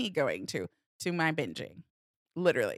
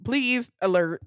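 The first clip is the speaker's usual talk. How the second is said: louder voice in your head demanding attention; insistent inner voice; 2 semitones higher, 6 dB louder, slower than usual. Retold or imitated. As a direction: nag yourself, pushing the volume up. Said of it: "it's the voice in your head".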